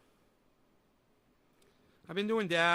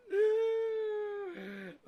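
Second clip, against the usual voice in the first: high squealing laugh; 8 dB louder than usual. Real, not acted. Laughing at cute dog.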